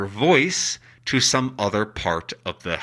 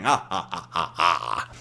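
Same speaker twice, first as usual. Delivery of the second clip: softer staged "ha ha", sinking low; hushed mock villain chuckle, fading; imitated ghostly laugh; 5 semitones lower, 5 dB quieter than usual. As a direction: mimic a phantom's laugh in passing. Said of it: "he was laughing".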